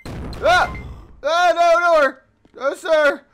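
panicked high screams and pleading; terrified, shouting in panic; scared horror game streamer. Real, not acted.

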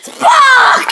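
high shouted name, rising each time; hammed-up villain roar. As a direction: scream the name out. Villain roaring his name.

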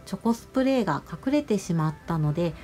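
usual speaking voice

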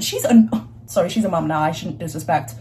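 ordinary talk

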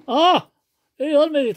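high startled yelp, then groan; startled exclamation; startled cry. Real, not acted. Father fed too much food.

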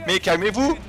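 high, loud plea to stop; shouting. Real, not acted. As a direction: yell at him to calm down. Shouting at an attacking character. (crie au monsieur de se calmer, aigu et fort)

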